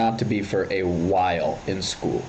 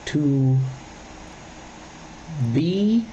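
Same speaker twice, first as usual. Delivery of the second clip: drawn-out To, long pause, then be; long dramatic pauses. Retold or imitated. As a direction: leave a huge pause between each word. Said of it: "pauses between every single word".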